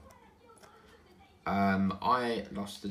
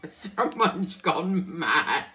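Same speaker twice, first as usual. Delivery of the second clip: laughing; 6 semitones higher, 11 dB louder, much slower than usual. Real, not acted. Laughing at TV reruns.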